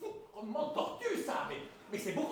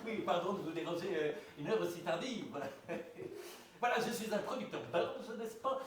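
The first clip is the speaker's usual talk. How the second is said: hammed-up Belgian producer on the phone; mock Belgian accent; 5 dB louder than usual. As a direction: fake Belgian accent, lightly put on. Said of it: "Je prends l'accent belge". (faux accent belge de producteur au téléphone)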